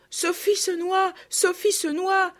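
put-on high, slow wail of a little girl; drawn-out, high-pitched lament; desperate sobbing cry. A narrator's reading. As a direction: wail it out in despair. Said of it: "sanglotait Marguerite".

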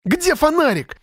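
put-on very high-pitched girl's squeal; piercing squeal. A narrator's reading. Lera demanding a light on the door, piercing squeal. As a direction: squeal it high above your normal voice. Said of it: "взвизгнул пронзительный голос Леры".

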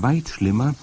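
usual speaking voice

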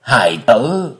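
put-on high, slow, pained old man; old prisoner father. A narrator's reading. Father begs his son for news, pleading slowly. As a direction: plead with your child, drawing it out.

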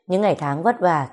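ordinary delivery, nothing marked

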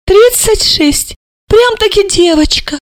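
high, scornful disbelief; mocking amazement. A narrator's reading. Mother mocking her daughter's age, incredulous. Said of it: "поразилась Альбина Яновна".

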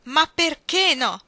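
loud, high, drawn-out protest; full-lunged shout. Acted. Iggy to Ruth, who refuses to stay, anguished shout. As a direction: yell it with all your breath. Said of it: "gridò Iggy con tutto il fiato che aveva nei polmoni".

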